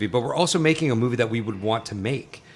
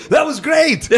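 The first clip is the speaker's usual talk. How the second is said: Arnold voice; 4 dB louder, slower than usual. Retold or imitated. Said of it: "he goes".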